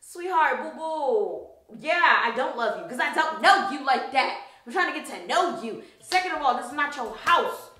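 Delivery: retold sassy rebuke; indignant telling-off. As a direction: put him in his place. Acted telling-off of clingy man.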